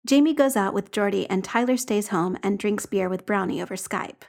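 ordinary delivery, nothing marked

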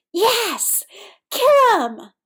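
high, loud bloodthirsty cheer; howl. A narrator's reading. JJ cheering as a player gets smashed, howling. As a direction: howl it like a rabid fan. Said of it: "howled J J".

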